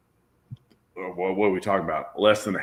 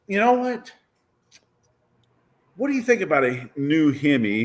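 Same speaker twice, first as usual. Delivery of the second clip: high-pitched start, imitating own bright idea; mock-eager proposal; retold eager pitch; 4 semitones higher than usual. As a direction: pitch the idea as a sudden brainwave.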